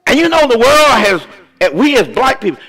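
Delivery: high, emphatic pulpit cry; full-force preaching. Real, not acted. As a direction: drive it home to the congregation, pausing between phrases.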